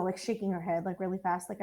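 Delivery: normal talking voice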